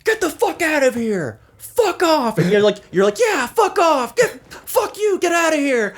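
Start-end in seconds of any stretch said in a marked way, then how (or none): 0.0-2.4 high, drawn-out retold yell; angry yelling. Yelling after the man walking away, angry high holler. 3.2-5.9 very high, piled-on shouts; imitated angry yelling. Friend joins in chasing him off, imitated angry yelling.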